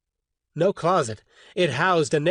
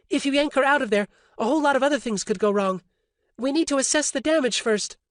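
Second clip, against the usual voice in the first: higher, fast woman's voice; Orly, urgent; 9 semitones higher, faster than usual. A narrator's reading. Orly warning Roberto, urgent woman's voice. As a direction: warn them quickly not to move her.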